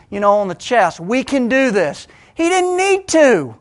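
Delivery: loud, high, driving sermon voice; full-force preaching. Acted. Preacher stirring his congregation, full-force preaching. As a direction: preach it out to the whole room.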